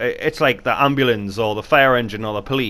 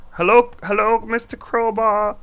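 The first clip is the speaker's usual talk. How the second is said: pleading little voice; 14 semitones higher, slower than usual. Pretend. Calling for a crowbar.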